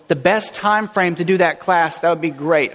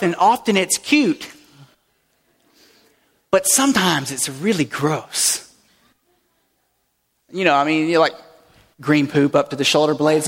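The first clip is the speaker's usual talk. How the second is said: ordinary delivery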